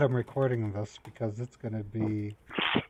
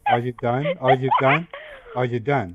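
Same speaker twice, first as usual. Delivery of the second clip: louder, impatient asking; 6 dB louder than usual. Real, not acted. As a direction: demand it repeatedly. Impatient repeated question.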